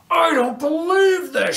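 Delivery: high, furious scream. A narrator's reading. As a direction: scream it in disbelief. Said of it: "she screamed".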